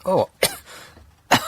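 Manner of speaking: coughing, clearing the throat; swallowed a bug. Real, not acted. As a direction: talk through a cough. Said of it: "think I've swallowed a bug".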